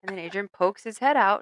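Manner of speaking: usual speaking voice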